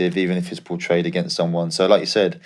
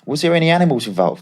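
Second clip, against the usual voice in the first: put-on higher, brisk Australian accent asking a blunt question; Australian agent voice; 8 semitones higher than usual. Australian agent grilling the player on the phone, higher Aussie accent. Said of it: "he's Australian".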